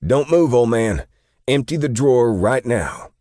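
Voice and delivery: high, loud robber's shout; shouted demand. A narrator's reading. Robber to the store clerk, shouting a demand. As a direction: bark orders at the top of the voice, urgent. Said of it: "a demand shouted by a male voice".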